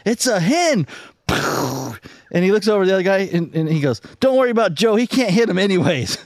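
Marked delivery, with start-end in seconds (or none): 0.1-0.9 high, insistent call to a hunting partner; calling out warning. Acted. Friend warns hard-of-hearing grandpa while hunting, calling out high. 4.2-6.2 high-pitched quoted hunter, dismissing the worry; acted reassurance. Friend tells the other guy about Joe, high dismissive call.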